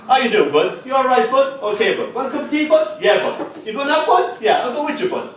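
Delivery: high, sing-song run of brotherly chat; chummy banter. Acted. Brother chatting to brother as nephew listens, chummy high banter. Mimicking repeated chats with brother.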